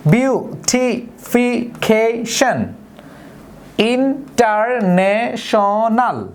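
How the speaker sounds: slow, each long word broken into syllables. Shown as a demonstration. Teacher slowly dividing polysyllable examples. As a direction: spell out every syllable. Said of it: "যেমন আমরা যদি বলি".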